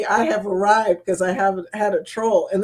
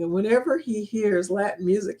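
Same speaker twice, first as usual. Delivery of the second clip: usual speaking voice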